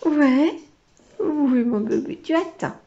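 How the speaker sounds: a light, high coo to calm the excited dog. Real, not acted. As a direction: soothe the puppy, lightly cooing. (petite voix douce pour calmer le chien)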